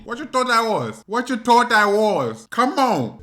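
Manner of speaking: cocky. Acted. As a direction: act the cocky kid squaring up. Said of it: "I'm trying to become cocky".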